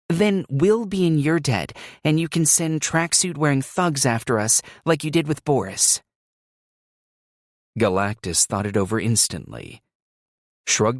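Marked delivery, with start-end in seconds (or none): 0.1-6.0 put-on higher, confident young woman pitching; Alicia voice. A narrator's reading. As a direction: sell the deal with conviction. Alicia answers Galactus.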